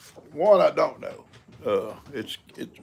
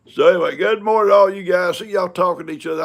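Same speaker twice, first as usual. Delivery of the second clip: cheerful loud greeting; 6 semitones higher, 9 dB louder than usual. Real, not acted. Greeting viewers.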